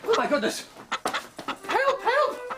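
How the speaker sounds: panicky cry for help. Acted. Inventor realises he is late, comic panicked yelps. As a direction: yelp it like a flustered inventor who is late. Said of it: "Mister Mentor was panicking".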